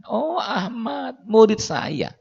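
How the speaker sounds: high, drawn-out oh of surprise; light up as you recognise the name; delighted recognition. Acted. Sheikh recognises his student. (syekh terkejut gembira, nada tinggi)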